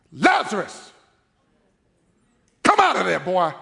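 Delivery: commanding call to raise the dead; loud voice. Acted. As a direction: call him out of the grave, forcefully. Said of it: "he said it in a loud voice".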